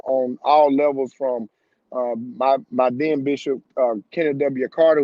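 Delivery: usual speaking voice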